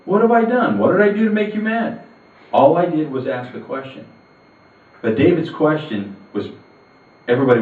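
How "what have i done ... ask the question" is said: very high, pleading disbelief; indignant protest. Acted. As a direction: protest your innocence, wounded and defiant. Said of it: "doesn't take this lying down".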